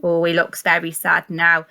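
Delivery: usual speaking voice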